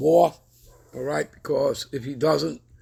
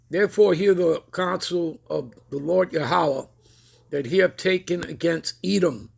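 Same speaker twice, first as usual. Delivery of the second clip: higher, louder solemn Bible reading; scripture reading; 3 semitones higher, 3 dB louder than usual. Acted. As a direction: proclaim the verse from the pulpit. Reads scripture aloud.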